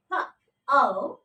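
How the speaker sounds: "P-O" as separate sounds, puh, ow. Shown as a demonstration. Teacher showing how to pronounce poaching, "P-O" sound by sound. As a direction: say "P" alone, then "O" as a diphthong. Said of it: "How to pronounce it?".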